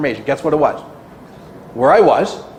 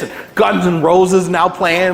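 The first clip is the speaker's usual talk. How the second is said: usual speaking voice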